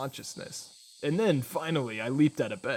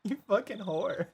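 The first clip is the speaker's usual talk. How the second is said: mock outraged lover; 7 semitones higher than usual. Mock outrage at the game heart.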